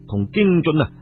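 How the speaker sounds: plain reading voice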